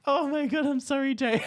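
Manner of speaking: high, loud, helpless laughing squeal; laughing apology. Real, not acted. Apologising to the absent model for the impression, squealing with laughter. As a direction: apologise through a real fit of laughter.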